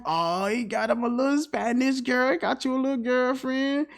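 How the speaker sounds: high, softened teasing voice; teasing restaurant owners. Acted. Both owners rib him about his girlfriend, high teasing voice. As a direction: tease a pal in a high put-on voice. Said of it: "They both come on like".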